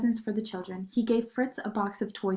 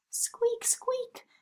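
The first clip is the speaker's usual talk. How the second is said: tiny high squeaks; mouse squeaks; 5 dB quieter than usual. A narrator's reading. Narrator voicing the scampering mice, high squeaks.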